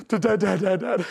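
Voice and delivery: stuttering, gasping sobs; mock uncontrollable crying. Spanked child who can't stop crying, imitated gasping sobs. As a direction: imitate a kid hiccuping between sobs. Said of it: "one of those cries you couldn't stop crying".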